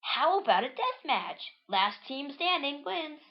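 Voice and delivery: high-pitched cartoon challenge; challenger character. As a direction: announce it high, slowing on the last part. Voicing leader's challenge.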